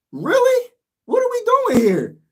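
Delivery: really, what are we doing; incredulous. Real, not acted. Incredulous at team's play.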